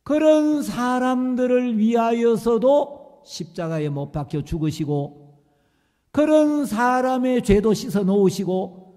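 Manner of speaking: loud, rising sermon cadence; fervent preaching. Acted. Preacher pressing grace on the congregation, fervent rising sermon. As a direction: preach it with building passion.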